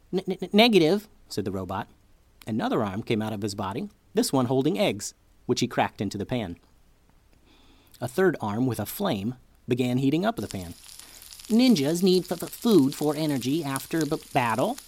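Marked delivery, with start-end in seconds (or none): 0.1-1.1 high glitchy robot, stuttering words; robot voice that skips like a glitch. Acted. 11.5-14.8 high glitchy robot, stuttering words; robot voice that skips like a glitch. Acted.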